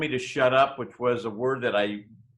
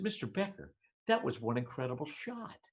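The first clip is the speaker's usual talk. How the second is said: much softer, stunned student's remark; hushed awe; 10 dB quieter than usual. Retold or imitated. Student to teacher after a near miss, hushed awe. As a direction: say it under your breath, awed.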